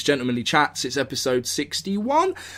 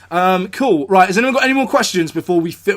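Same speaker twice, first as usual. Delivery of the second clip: ordinary talk, nothing marked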